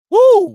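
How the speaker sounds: a real high, loud woo of delight; loud joyful woo; excited whoop. Real, not acted. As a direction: whoop like you are hyping up a friend. Excited reaction to Google me.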